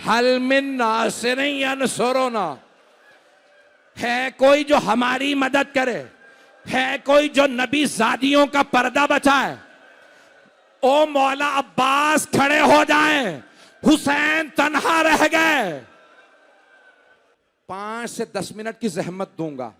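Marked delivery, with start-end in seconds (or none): 0.0-9.6 high, loud, slow plea for helpers; crying out for help. Retold or imitated. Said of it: "चीख चीख के कह रहे हैं". 10.8-15.8 high, loud, slow wailing call; anguished shout. Acted. Preacher cries out to Abbas.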